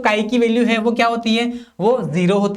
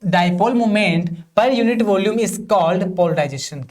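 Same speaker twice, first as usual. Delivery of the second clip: slow, even reading for students to copy; slow dictation; much slower than usual. Shown as a demonstration. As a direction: dictate each word slowly so they can write. Dictating the definition again. (धीमे-धीमे notes लिखवाना)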